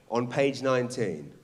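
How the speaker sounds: usual speaking voice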